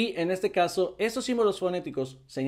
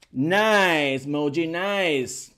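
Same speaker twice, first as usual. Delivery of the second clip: louder, drawn-out "nice"; delighted praise; 4 dB louder, much slower than usual. Real, not acted. Teacher praises Moji after practice, delighted. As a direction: cheer the learner with long vowels.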